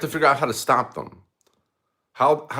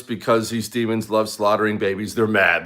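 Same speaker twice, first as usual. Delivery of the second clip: normal talking voice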